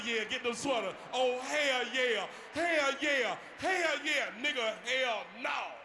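loud, slow drawn hell yeah cheers; loud mocking hype. Comic hypes the sweater brothers with loud cheers. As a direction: whoop it up in mock agreement.

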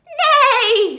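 high, very loud neigh; whinny out loud; horse neigh. A narrator's reading. Horse hears of the plot, loud high neighing. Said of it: "said the horse".

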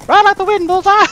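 high, loud cheering on; rally the horse like a cowboy; excited shout. Pretend.

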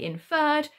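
"inferred" with a punched second syllable; "inferred" with a full, stressed "er"; stress on the FERRED. Shown as a demonstration. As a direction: hit the end of "inferred" as in-FERRED. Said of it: "but we do say inferred".

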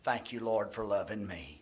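earnest pastor's prayer, steady and sincere; closing prayer. Acted. Pastor praying over the congregation, earnest and steady. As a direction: pray it aloud for the whole room.

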